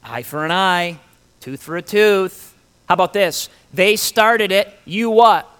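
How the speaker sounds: mock goading; voices of vengeance. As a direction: egg someone on to retaliate, lightly acted. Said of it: "we constantly hear those voices".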